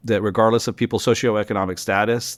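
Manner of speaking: ordinary talk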